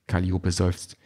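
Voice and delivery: ordinary delivery, nothing marked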